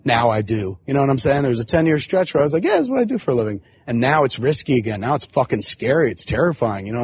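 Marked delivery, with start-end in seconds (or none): 2.6-3.6 smug, cocky. Retold or imitated. Smug voice of past self.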